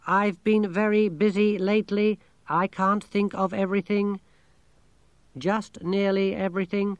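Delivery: high, monotone computer voice; talking machine, matter-of-fact. A narrator's reading. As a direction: report it evenly like a machine.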